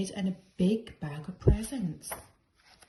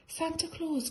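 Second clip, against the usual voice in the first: excited cry; 8 semitones higher than usual. A narrator's reading. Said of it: "cried Paddington".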